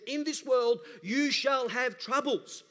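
proclaiming Jesus' words. Retold or imitated. Preacher quoting Jesus to the church, slow and loud. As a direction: ring it out like scripture.

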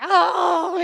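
high, loud acted "oh"; panicked clinging cry. Peter clinging to Jesus for dear life, panicked cry. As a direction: cry out as if drowning. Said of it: "It has to be like".

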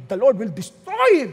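loud mock prayer of vengeance; imprecatory prayer. Preacher mimics a spouse praying against the other, loud mock vengeance. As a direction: thunder it out with a heavy punch on "destroy". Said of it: "imprecatory prayer".

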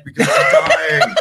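high, loud, slowed laughing wail; hammed-up death wail, laughing; wailing overreaction. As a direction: melodramatic dying wail through laughter. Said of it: "overreacting in a huge fucking way".

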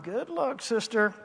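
soft, dry mock well-wishing; sarcastic good luck. Pastor doubting her plan, quiet and wry. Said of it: "And I'm like".

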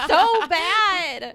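high, very loud outburst; loud disgusted verdict. Real, not acted. Podcaster reacts to the cheesy sweet berry line, loud outburst. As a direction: cry out in amused disgust.

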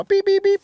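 high electronic beep sounds; beeper beeps. Retold or imitated. Telling how his beeper went off, imitating the high beeping. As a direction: chirp it out high like a pager.

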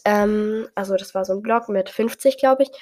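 ordinary delivery

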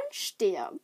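ordinary delivery

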